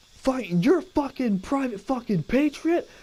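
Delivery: high, slow, in-your-face bark, imitated; drill sergeant. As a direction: chew out a recruit in disbelief. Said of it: "He goes".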